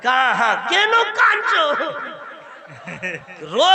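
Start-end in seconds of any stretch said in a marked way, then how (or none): 0.7-1.6 mock shout. Calling to crying grandson.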